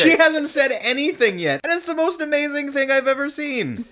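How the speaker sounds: high-pitched fan squeal of awe; gushing. Real, not acted. Fan raving about her amazing appearance in the trailer, high-pitched gushing. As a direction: gush it, voice shooting way up.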